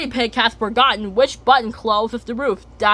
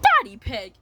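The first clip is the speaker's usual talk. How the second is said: high scolding call. Retold or imitated. Mummy scolding.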